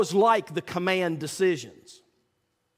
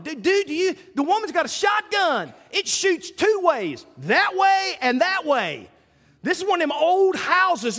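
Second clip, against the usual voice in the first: panicked pleading with the commander, much higher; alarmed protest; 9 semitones higher than usual. Retold or imitated. Young officer begging the commander to wait for tactical, high alarmed pleading.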